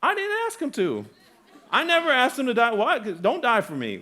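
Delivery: high, loud, protesting sinner; indignant lost person. Retold or imitated. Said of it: "And there's like".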